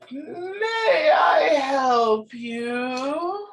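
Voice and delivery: put-on high, slow, quavering old woman; elderly voice. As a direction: croak it out like a frail old lady. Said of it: "elderly voice just come out and be like".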